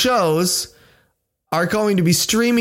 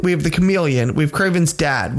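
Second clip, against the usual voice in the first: usual speaking voice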